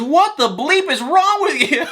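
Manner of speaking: high, drawn-out mock outrage; exasperated mom. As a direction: imitate an incredulous scolding mother, high and slow. Voicing outraged mom scolding her son.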